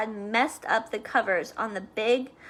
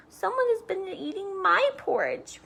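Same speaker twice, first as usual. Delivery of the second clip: put-on higher little baby bear; wee small voice; 7 semitones higher than usual. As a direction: squeak it like a tiny bear cub. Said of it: "in his wee small voice".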